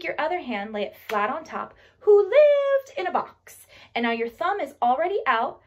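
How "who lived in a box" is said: high, loud, stretched-out storytelling; playful recital. Acted. Teaching children the turtle rhyme, playful high recital. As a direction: sing it out high and slow. Rhyme line with hand action.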